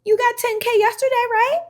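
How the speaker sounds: gentle high reassuring tone; kind reassurance. Retold or imitated. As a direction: coax them gently like a kind mentor. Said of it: "I would say".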